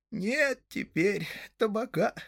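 high, slow, rueful hobbit voice; dejected. A narrator's reading. Hob tells the guests about tobacco, dejected. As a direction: lament it slowly in a high voice. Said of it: "сокрушённо покачал головой Хоб-Сеновал".

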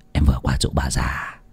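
quiet, emotionless telling of mother's death; indifferent. Acted. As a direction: report the death like it means nothing. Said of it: "nói rừng rưng như không có chút gì đau buồn".

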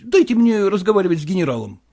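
plain reading voice

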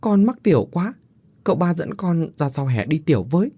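put-on high-pitched small child voice; whining little boy. A narrator's reading. Little Hậu begs his uncle to go outside, whining. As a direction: plead like a child who needs to pee. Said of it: "Thằng bé Hậu mặt nhăn nhó".

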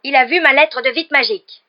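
high, very loud outburst; agitated, shouting insistence; furious accusation. Acted. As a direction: hurl the accusation at full voice. Caretaker keeps accusing Harry.

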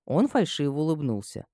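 plain reading voice

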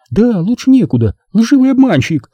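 high-pitched indignant woman's voice; seething indignation. A narrator's reading. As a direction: hurl the accusation in feminine outrage. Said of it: "колокочущим от возмущения голосом". (клокочущим от возмущения голосом)

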